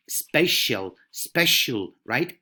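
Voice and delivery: ordinary delivery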